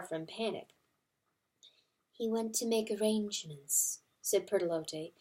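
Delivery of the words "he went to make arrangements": soft, hushed mother hen; gentle hen. A narrator's reading. Hen calming grieving mice, soft and gentle. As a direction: soothe them quietly. Pertolote voiced softly.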